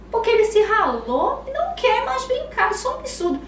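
put-on high, loud indignant child voice; bratty little girl complaining; toddler daughter. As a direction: play a three-year-old outraged at the fuss. Mother voicing toddler daughter.

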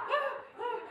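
high startled oh oh, with a laugh; mock panicked yelp; flustered squeal. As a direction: caught off guard and panicking. Startled by lady's joke.

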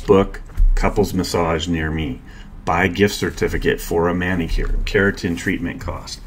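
ordinary delivery

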